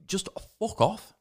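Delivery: slow, high retold outburst; exasperated dismissal. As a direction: drag it out in exasperation. Retold losing temper at shopkeeper.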